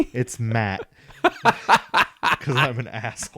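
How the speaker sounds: real laughter breaking into the words; amused confession through laughs; laughing. Real, not acted. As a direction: blurt it out while cracking up. Jokingly betrays buddy's secret name.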